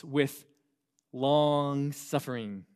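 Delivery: long suffering stretched slowly; drawn out. Shown as a demonstration. Pastor reading verse, drawing out long suffering. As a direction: draw out long suffering, each syllable slow. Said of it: "I say it like that intentionally".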